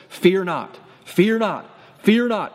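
stepped-up fear not, fear not, fear not; rising repeated command. Shown as a demonstration. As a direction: build the fear not three times, climbing. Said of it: "repeated command building".

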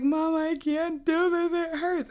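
high, slow, pained plea; teen begging her mother during stitches, high pained plea; pleading to mom. Retold or imitated. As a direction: whimper to mom that it hurts.

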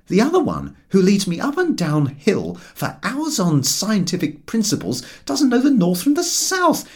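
a lightly voiced indignant companion; George's lecturing voice. A narrator's reading. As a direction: list the facts pointedly. George argues in story.

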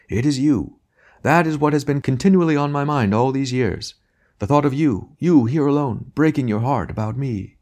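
high, theatrical lament; grand tragic wailing. Acted. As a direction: overact the grieving lover. Tragedian's hammy lament.

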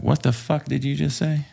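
high-pitched stunned disbelief; shocked retort. Retold or imitated. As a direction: bristle in disbelief at the insult. Retold indignant reply, high.

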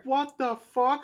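high, slow, incredulous; replaying a stunned reaction; retold disbelief. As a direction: drag it out in high-pitched disbelief. Retelling shocked reaction to twist.

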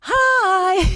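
a sugary greeting, sung up high; sing-songy hi. Shown as a demonstration. Flight attendant greeting each passenger, sing-song Hi. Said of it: "I started doing it".

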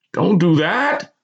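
high, slow urgent plea; pleading. A narrator's reading. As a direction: plead with someone to stop. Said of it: "the same unfamiliar voice plead".

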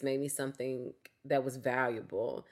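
usual speaking voice

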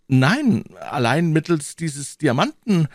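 put-on high, emphatic young hero; young man's voice. Young D'Artagnan answering Tréville about the diamond, emphatic high voice. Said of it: "sprach der junge Mann".

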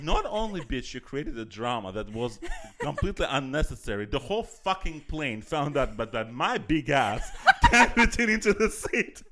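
hammed-up fury at a nosy passenger; retold furious retort. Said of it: "I was so furious".

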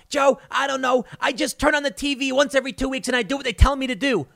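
high, quick clueless voice; mock dumb TV viewer. Bill Burr mocking a TV viewer for Joe, high and clueless. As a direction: sound like someone who just obeys the TV. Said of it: "Bill Burr. He was like".

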